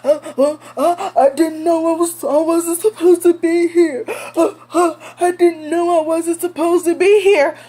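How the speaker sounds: high, whining mock crying; mock whimper. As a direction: fake sobbing excuse. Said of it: "looking all shocked and shit".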